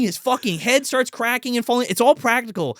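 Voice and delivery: ordinary delivery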